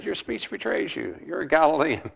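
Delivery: a hint of a teasing girl calling him out; servant girl taunting. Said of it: "She said".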